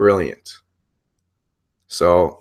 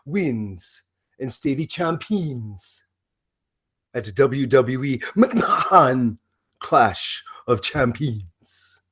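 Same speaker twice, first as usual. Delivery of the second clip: Broken Matt Hardy impression. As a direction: play the unhinged, grandiose wrestler announcing matches. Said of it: "the very complex character of Broken Matt Hardy".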